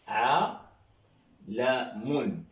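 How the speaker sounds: عالم drawn out very slowly, each syllable held; slow model word. Shown as a demonstration. Teacher to French class modelling Arabic word, very slow. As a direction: stretch عالم out slowly so students can follow. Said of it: "On dit par exemple".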